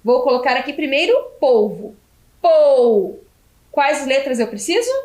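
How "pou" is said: loud, drawn-out single syllable; sounded-out syllable. Shown as a demonstration. Teacher sounds out the first syllable for pupils, drawn out. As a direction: stretch POU out firmly for spelling.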